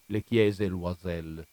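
plain reading voice

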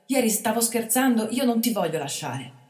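put-on boy imploring the bandit to leave; pleading. A narrator's reading. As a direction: beg him to come. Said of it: "supplicò il ragazzo".

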